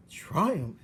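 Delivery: high, loud astonished question; amazed servant girl. Acted. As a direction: echo the word in wonder. Meg amazed.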